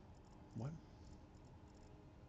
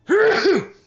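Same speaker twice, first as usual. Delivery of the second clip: loud sneeze inside a helmet; sudden sneeze, muffled by helmet; 20 dB louder than usual. Real, not acted. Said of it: "Sneezed in the helmet".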